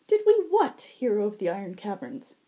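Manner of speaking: high, loud, playful fae tease; teasing high herald. Acted. Shinnah teasing the blushing Zack, high and playful. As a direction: tease him, lilting up high.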